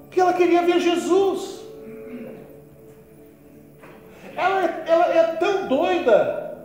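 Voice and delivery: fervent pulpit delivery; impassioned preaching. Real, not acted. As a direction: preach it forcefully, answers dropping after questions. Preaches at full force.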